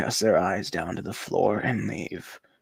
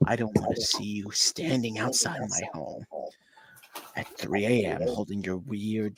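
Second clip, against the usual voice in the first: ordinary talk, nothing marked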